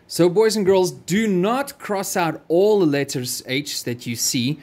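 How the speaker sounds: ordinary talk, nothing marked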